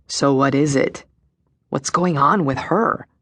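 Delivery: lightly gruff, demanding woman. Acted. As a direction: press for answers, a touch gruff. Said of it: "the second voice demanded".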